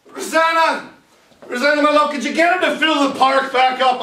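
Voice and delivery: high, loud call across the room; loud, eager call to his love Rosanna. Acted. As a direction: shout for her to come over. Calling out to Rosanna.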